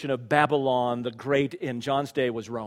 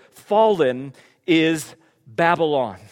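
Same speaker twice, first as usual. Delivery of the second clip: slow, drawn-out Fallen; solemn slow repetition; slow, weighty; 6 semitones higher, 13 dB louder, slower than usual. Acted. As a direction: let each word land slowly. Repeated slowly for weight.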